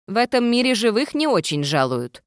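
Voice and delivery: ghost voice. A narrator's reading. Ghost character voice.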